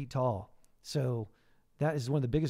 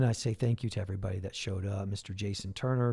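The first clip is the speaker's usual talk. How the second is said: usual speaking voice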